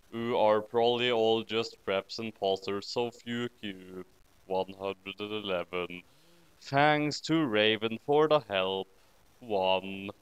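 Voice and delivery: plain reading voice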